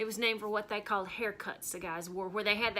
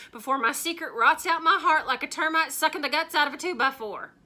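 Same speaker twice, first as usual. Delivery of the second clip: higher and louder, swelling to a cry; impassioned outburst; 7 semitones higher, 12 dB louder, faster than usual. Acted. Confessing her burden to the fan club, bursting out loud.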